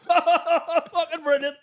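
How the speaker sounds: slow, high, laughing through the words; laughing delight. Retold or imitated. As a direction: savour it, laughing hard. Delighted exclamation while laughing.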